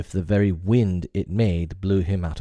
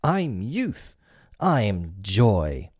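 Peter's bold answer; much slower than usual. Acted. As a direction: toss the answer off proudly like a boy. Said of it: "Peter answered at a venture".

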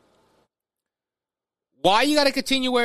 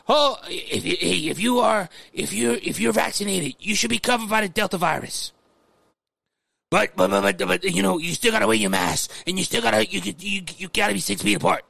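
imitating Fauci. Mocking the top doctor's mask advice to his viewers, light impression. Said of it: "I don't know why I do that guy's voice".

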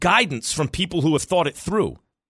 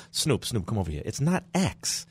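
put-on a dad's Bronx accent, called across the room; 2 semitones lower, 6 dB quieter than usual. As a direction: scold in a light Bronx accent. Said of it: "In his Bronx accent".

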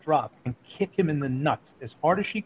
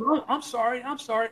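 higher-pitched imitation of a cowed apology; mock meek apology; 7 semitones higher than usual. Cowed wrestler apologizing to a twelve-year-old girl, mock meek. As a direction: imitate someone backing down, pitched up.

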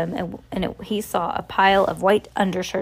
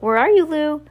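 higher, raised searching call; 7 semitones higher, 4 dB louder than usual. A narrator's reading. Shopkeeper calls to the delivery person, calling out. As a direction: call across the store to find someone. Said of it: "the shopkeeper called out".

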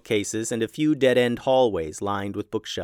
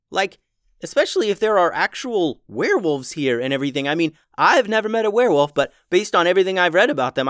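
higher, fast, anxious youngster; nervous kid voice; 6 semitones higher, 4 dB louder than usual. Acted. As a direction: rush it out, worried child.

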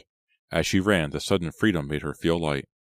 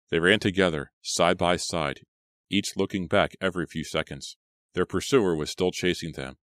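ordinary delivery, nothing marked

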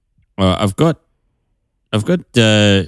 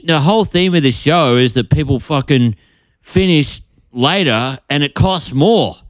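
ordinary talk

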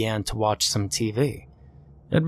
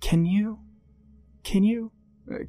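put-on hesitant young woman; 10 semitones higher than usual. Hesitant maid stammering.

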